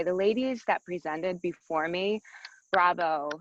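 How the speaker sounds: usual speaking voice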